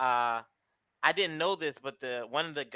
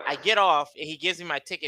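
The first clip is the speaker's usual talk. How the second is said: usual speaking voice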